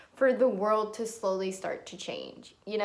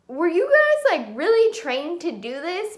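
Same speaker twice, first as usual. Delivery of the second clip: sudden high, louder disbelief; can't believe what you saw; incredulous; 5 semitones higher, 4 dB louder than usual. Real, not acted. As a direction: were you guys shot up high in disbelief. Incredulous question at the cops.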